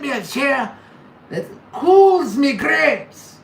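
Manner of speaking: hammed-up Scottish brogue, high and loud, slow; loud mock Scottish rant; mock Scottish accent. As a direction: play a loud Scottish lad complaining. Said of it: "Or at least buy me a kilt".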